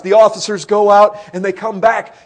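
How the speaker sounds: loud, driving sermon storytelling; forceful preaching. Real, not acted. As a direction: preach the story at full volume.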